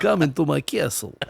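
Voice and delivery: cartoon vampire count's invitation; hammed-up vampire host; Dracula. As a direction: beckon in a mock Transylvanian accent.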